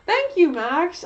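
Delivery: slow high mock thank-you; sarcastic thanks. Slow drawn thanks, different timbre.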